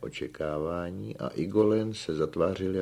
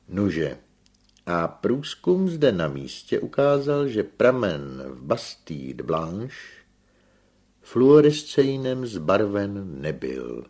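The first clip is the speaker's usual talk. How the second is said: loud, slow, deliberate pronouncement; measured, raised-pitch expert voice; engineer resuming his lecture; 4 semitones higher, 8 dB louder, slower than usual. A narrator's reading. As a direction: announce the findings with drawn-out emphasis.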